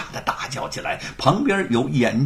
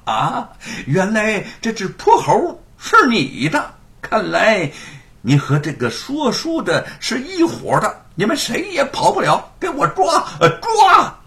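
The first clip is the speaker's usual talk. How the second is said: stamping angry yell; 7 semitones higher, 5 dB louder than usual. A narrator's reading. Chief constable realizing the monkey is his: angry yell building. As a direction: rage and stamp your foot. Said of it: "顿足叫道".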